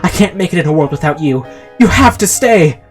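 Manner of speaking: hammed-up melodramatic begging, rising to a cry; desperate plea. A narrator's reading. Bill begs Chester to stay.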